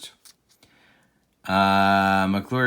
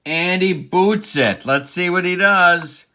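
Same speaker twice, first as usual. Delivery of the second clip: higher, louder play-by-play burst; raised, urgent baseball commentary; excited error call; 5 semitones higher, 5 dB louder than usual. Pretend. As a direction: jump on the fumble like a live announcer. Calling a fielding error live.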